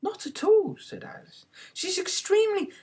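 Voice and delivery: put-on high, blunt girlish reply; a hint of a frank child; lightly voiced young girl. A narrator's reading. Alice answering the cat.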